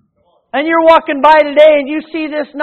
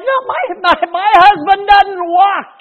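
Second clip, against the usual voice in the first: disbelief; 7 semitones higher than usual. Acted. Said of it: "I'm like".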